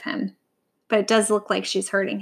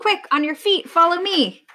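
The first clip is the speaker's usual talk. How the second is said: high-pitched call to action; urgent command; 7 semitones higher than usual. A narrator's reading. Vulture leading lion to the swamp, urgent command. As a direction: urge him up in a raised pitch.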